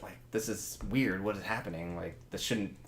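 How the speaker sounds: hushed, low aside between guards; guards whispering. Acted. Guard to fellow guard about the odd costumed dancers, whispering low. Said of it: "like, whispering to each other".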